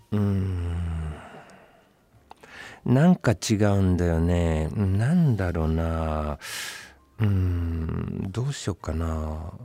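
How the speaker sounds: ねちっこい監督の真似; fussy director. Retold or imitated. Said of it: "粘着型".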